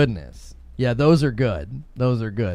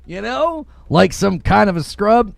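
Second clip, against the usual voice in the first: higher-pitched playful outrage; mock indignant; 6 semitones higher than usual. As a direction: give the viewer a hard time in jest. Mock tirade at Big Fry.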